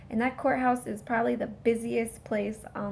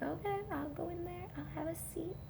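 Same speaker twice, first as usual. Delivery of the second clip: much softer, higher, sheepish okay; meek compliance; 6 semitones higher, 12 dB quieter than usual. Retold or imitated. Defendant backing down to his lawyer, soft and sheepish. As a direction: give in sheepishly. Meekly complying after demanding judge.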